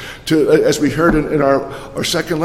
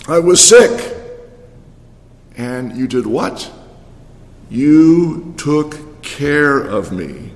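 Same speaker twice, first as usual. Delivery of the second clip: preached scripture; 2 semitones lower than usual. Acted.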